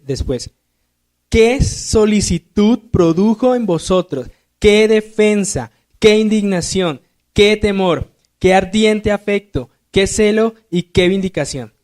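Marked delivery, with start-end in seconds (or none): 1.3-11.7 high, slow, exclamatory reading of each qué; reading Scripture aloud with exclamatory emphasis. Shown as a demonstration. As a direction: read each line as an amazed exclamation. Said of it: "se dan cuenta que está dentro de los signos de admiración".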